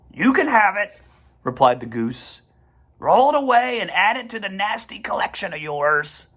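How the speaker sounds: high, scornful goose voice; disdainful goose. A narrator's reading. Goose tells Templeton to take the egg with disdain. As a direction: goose brushing off the rat.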